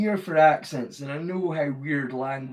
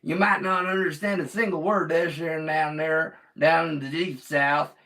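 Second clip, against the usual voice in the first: Southern American accent; 3 semitones higher, 7 dB louder than usual. Shown as a demonstration. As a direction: whoop it up like a Southern boy. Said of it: "down to the Southern boys".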